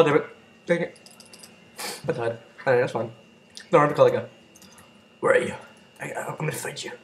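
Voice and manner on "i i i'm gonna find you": whispered creepy threat; menacing whisper. Real, not acted. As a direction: breathe the threat softly. Hunting an enemy.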